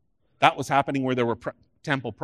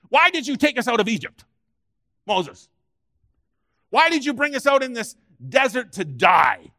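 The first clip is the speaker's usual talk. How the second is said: whiny complaint, higher and louder; grumbling Israelites; 9 semitones higher, 7 dB louder than usual. Acted. Israelites griping at Moses in the desert, whining loudly. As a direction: moan at Moses like ungrateful wanderers. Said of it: "they began to grumble".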